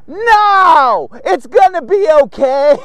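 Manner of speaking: high, loud, drawn-out mock brush-off; dismissive reassurance. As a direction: wave off the worry, hammed up. Acted brush-off of wife's warning.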